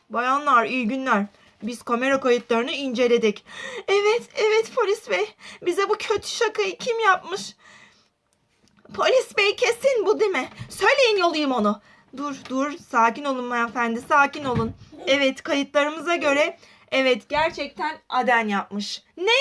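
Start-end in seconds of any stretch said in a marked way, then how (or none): 0.1-3.5 police officer. Acted. Polis rolü, alçak ses. 12.2-19.0 police officer. Acted. Polis rolü, alçak ses.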